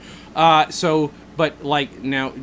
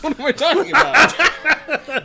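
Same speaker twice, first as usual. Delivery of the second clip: high, slow laughing question; 13 semitones higher, 7 dB louder, slower than usual. Real, not acted. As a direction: laugh through the words. Laughing at himself mid-joke.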